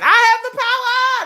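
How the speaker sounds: high, loud heroic He-Man cry; mock superhero battle cry. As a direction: belt it like He-Man raising his sword. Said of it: "like He-Man".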